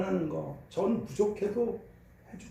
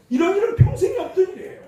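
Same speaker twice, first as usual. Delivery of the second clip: laughing in disbelief; 12 semitones higher, 10 dB louder, faster than usual. Real, not acted. Marvels at grandson's gift.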